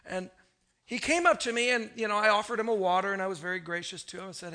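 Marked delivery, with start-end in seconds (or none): none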